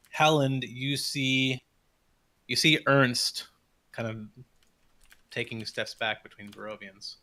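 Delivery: ordinary delivery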